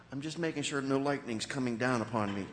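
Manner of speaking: ordinary talk, nothing marked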